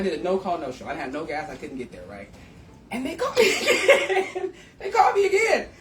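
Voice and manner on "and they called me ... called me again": high, loud, laughing through the words; real laughter breaking into a high-pitched exclamation; laughing disbelief. Real, not acted. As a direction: crack up in amazed delight at the news. Laughing at the unlikely callback.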